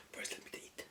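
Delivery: breathy whispered aside; whispering. Real, not acted. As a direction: whisper it quietly to yourself. Speaker pauses story to eat.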